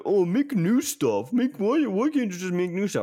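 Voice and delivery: a hint of a whining internet commenter; mocking whiny critics. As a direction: sneer at the online crowd. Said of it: "the discourse on Twitter was like".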